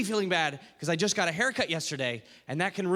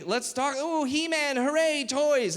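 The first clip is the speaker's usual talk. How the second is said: put-on higher-pitched, gleeful child voice; happy little kid; 6 semitones higher, slower than usual. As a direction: squeal over toys like a delighted kid. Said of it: "One day I was happy".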